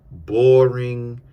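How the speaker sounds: ordinary delivery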